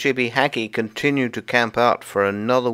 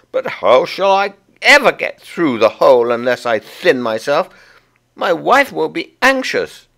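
higher, worried squirrel voice; higher anxious squirrel; Timmy the squirrel; 9 semitones higher, 7 dB louder than usual. Acted. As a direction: fret as the stuck husband.

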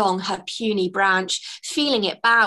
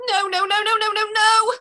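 high, rising, louder panicked pleading; panicked sobbing no; 12 semitones higher, 4 dB louder than usual. Acted. Fairy Twigs sobs as the stoat races after her. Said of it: "sobbed Twigs".

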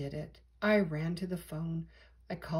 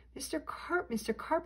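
higher call, held back in volume; mild yell; 7 semitones higher, 4 dB quieter than usual. A narrator's reading. Boy calling down into the pool, understated yell. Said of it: "I yelled".

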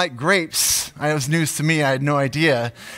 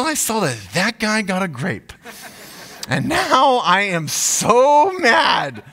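mock fury; 4 semitones higher than usual. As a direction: play the jealous monkey stretching each word in fake anger. Voicing the jealous monkey as himself.

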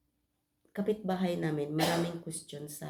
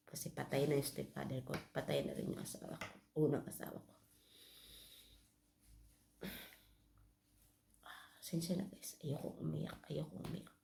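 much softer, breaking into near-whispered tears; crying quietly; 3 semitones lower, 10 dB quieter, faster than usual. Real, not acted. Vlogger remembering her dead husband, quietly crying. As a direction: let the grief pull the voice down to a breath.

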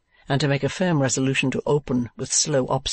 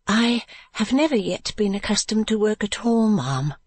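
ordinary delivery, nothing marked

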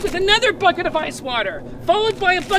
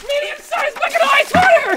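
shouting; 7 semitones higher, 3 dB louder than usual. Real, not acted. Announcing each bucket dumped.

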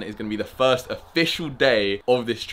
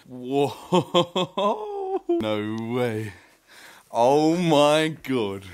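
amazed; much slower than usual. Real, not acted. First sight of glass igloo.

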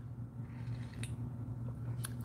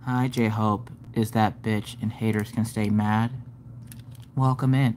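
monotone; 9 semitones lower, 8 dB quieter than usual. Shown as a demonstration. Mocking someone just sitting there greeting viewers, monotone. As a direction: greet them with zero enthusiasm. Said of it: "how monotone your ass is".

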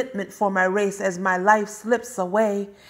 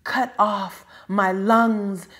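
usual speaking voice

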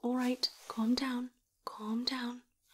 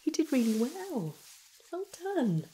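praise; 5 semitones higher, 5 dB louder than usual. Acted. Praising patient after treatment.

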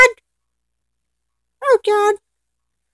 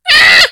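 short high, loud scream; 4 semitones higher, 4 dB louder than usual. Real, not acted. Player attacked by zombies in the game, screaming.

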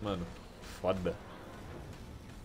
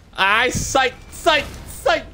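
high, loud panicked squeal; panicked yelp; 10 semitones higher, 6 dB louder, much slower than usual. Real, not acted. Gamer shrieking as the beast charges, panicked. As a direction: scramble away in real panic.

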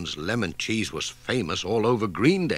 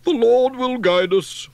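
high, loud churchy voice; pious vicar; 13 semitones higher, 7 dB louder than usual. A narrator's reading. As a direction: intone it like a hearty parson.

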